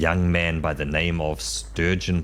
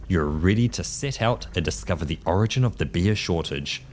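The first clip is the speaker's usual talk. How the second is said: usual speaking voice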